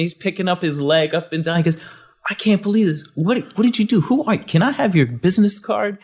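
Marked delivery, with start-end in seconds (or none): 2.3-6.0 much higher, eager tumbling questions; high, breathless awe after being healed; excited disbelief. Retold or imitated. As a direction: play the man overwhelmed and thrilled. Healed man's excitement retold.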